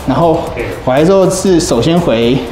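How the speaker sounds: usual speaking voice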